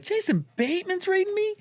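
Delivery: high put-on disbelief; can't believe who's replacing you; high squeaky mock shock; incredulous.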